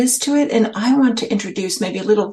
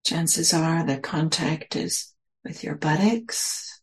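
measured meditation voice, phrase by phrase; guided meditation. Real, not acted. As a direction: guide listeners into stillness, leaving space between phrases.

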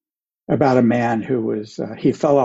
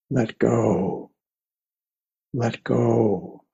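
big mystical voice; much slower than usual. Acted. Buddha answers the man hanging from a cliff, big mystical voice. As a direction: intone it like a mysterious voice from above. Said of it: "in this big mystical voice".

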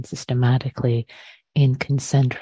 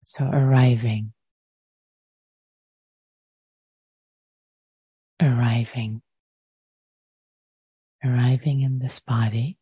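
unhurried meditation guidance with long pauses; guided meditation. Real, not acted. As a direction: guide listeners into the breath, leaving space after each phrase.

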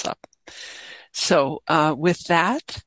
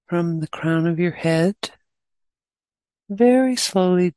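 guided meditation. Real, not acted. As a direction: guide them gently, leaving long pauses between phrases.

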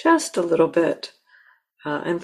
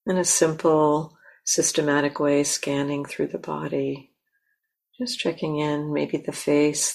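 guided meditation. Acted. Teacher guiding the listeners to land in themselves, calm and unhurried. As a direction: gently lead them into stillness.

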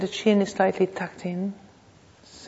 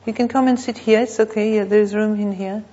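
calling out an invitation; 2 semitones higher, 7 dB louder than usual. Real, not acted. Teacher to latecomers finding a seat, calling out an invitation. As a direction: raise your voice to wave someone in.

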